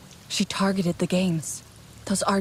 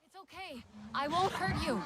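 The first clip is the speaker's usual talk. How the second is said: much softer, gentle and high; calming a frightened dire horse, soft and tender; soothing an animal; 9 semitones higher, 11 dB quieter than usual. Acted. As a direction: hush it gently so it won't bolt.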